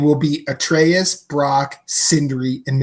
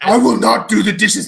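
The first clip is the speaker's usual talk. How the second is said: Kratos voice; 6 semitones higher than usual. Retold or imitated. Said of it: "Kratos scene".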